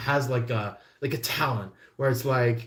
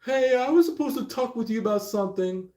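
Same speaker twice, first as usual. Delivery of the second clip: much higher, fast put-on pestering voice; hammed-up nagging chirp; mock nagging caller; 10 semitones higher, faster than usual. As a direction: pester them in a quick high voice. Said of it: "going like".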